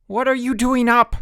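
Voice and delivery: high, loud bellow. A narrator's reading. Counselor bellowing at the bunks. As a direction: roar it across the cabin. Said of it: "bellowed Chip".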